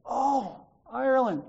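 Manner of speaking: light, pleased surprise; mild retold aha; surprised realization. Realizing where the accent was from.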